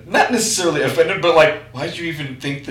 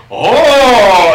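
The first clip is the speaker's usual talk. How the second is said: excited anime fan; 7 semitones higher, 10 dB louder than usual. Pretend. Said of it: "I'll just sit there and be like".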